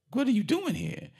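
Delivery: high surprised mother; mock a startled mom. Mother voiced in retelling.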